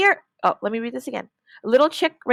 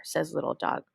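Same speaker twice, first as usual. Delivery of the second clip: normal talking voice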